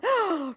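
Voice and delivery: big storybook gasp; dramatic gasp. Acted. Teacher reading crayon book aloud, dramatic gasp. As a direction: gasp sharply in mock alarm.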